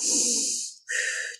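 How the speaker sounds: long soft breath out; slow exhale. Shown as a demonstration. Showing viewers how to calm down, a long exhale. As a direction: breathe it out slowly. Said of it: "Let me breathe this out".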